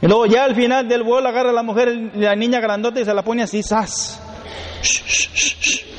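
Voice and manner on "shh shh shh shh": unvoiced shushing; hushing. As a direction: shush the child to sleep. Mother hushing child.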